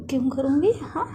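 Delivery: loud, challenging retort; acted huffy outburst; indignant denial. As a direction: throw the question back hotly.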